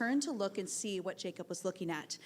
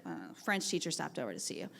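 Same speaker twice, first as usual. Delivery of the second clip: lower, softer, offhand reply; flat aide; 9 semitones lower, 9 dB quieter than usual. Retold or imitated. Aide replying offhandedly without looking up, low and soft. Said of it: "She simply said".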